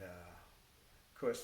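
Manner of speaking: usual speaking voice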